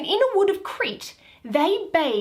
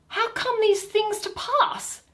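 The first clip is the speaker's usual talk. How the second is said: startled wonder; 9 semitones higher than usual. Acted. Titania shocked at the ass.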